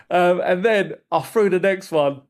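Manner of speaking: ordinary delivery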